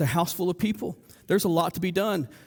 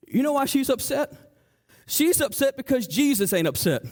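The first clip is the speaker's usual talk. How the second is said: normal talking voice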